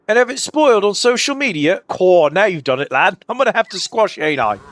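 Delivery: put-on a light British accent on a threatening tentacle; hint of a menacing British thug; British villain voice. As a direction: read it as a cheeky British brute. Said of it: "he's distinctly British".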